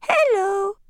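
a tiny voice from inside a box. Acted. Hidden doll calls out from the package. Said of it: "a little voice, bird chirp".

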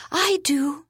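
put-on a small, sad high child's voice; murmured sadly. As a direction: murmur it like a hurt little girl. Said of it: "Keiko murmured sadly".

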